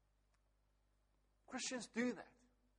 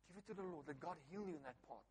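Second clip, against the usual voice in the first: soft, then quicker gentle plea; hushed repeat; 3 semitones lower, 15 dB quieter, faster than usual. Real, not acted. Pastor urging the congregation, soft and quick. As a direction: press the point gently, barely above a murmur. Repeated softly with faster close.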